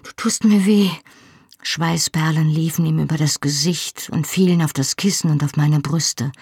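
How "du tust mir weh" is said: high, breathless begging; a woman pleading through pain, pitched high; pained gasping plea. A narrator's reading. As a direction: beg him to stop, hurting. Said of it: "keuchte ich".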